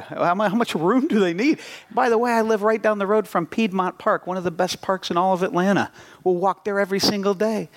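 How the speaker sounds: pleading. Retold or imitated. Pleading reasons to adoption lady.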